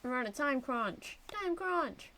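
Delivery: ordinary talk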